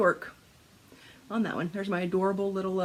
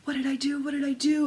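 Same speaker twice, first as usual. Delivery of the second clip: high-pitched, frantic retold freak-out; mock panic; 7 semitones higher, faster than usual. Retelling her stitching scare to viewers, in mock panic. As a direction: play up the panicked self-questioning.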